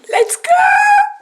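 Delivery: high, loud laughing cheer; excited shout. Real, not acted. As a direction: shout it out happily. Cheering off to restaurant.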